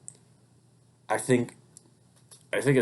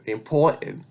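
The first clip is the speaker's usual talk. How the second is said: higher-pitched accidental New York accent; exaggerated New York accent, pitched up; slipped-in New York accent; 5 semitones higher, 3 dB louder, slower than usual. Real, not acted. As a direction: let a New York accent slip out on the word. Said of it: "I just do an accent".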